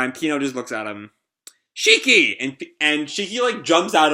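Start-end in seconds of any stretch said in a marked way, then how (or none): 1.8-2.4 high, loud cry of the name; calling out. Acted. Pino seeing Shiki arrive, high call. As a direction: call out to the rescuer.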